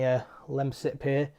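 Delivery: usual speaking voice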